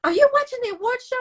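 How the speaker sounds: sweetly calling her upstairs; imitating mother-in-law. Mother-in-law calling her daughter-in-law to the TV, high and sweet.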